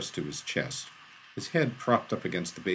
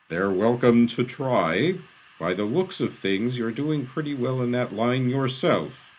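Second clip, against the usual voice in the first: loud, raised, imperious voice; booming retort; 4 semitones higher, 6 dB louder than usual. A narrator's reading. Eden retorts to Shaw over the damage, booming. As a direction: project it slowly, then press on.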